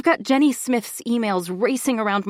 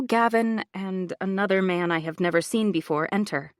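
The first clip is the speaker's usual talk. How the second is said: plain reading voice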